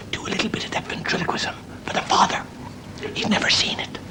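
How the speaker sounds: hushed, unvoiced stage whisper; confidential whisper. Daughter asking her husband for a trick, whispering. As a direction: whisper it privately to your husband.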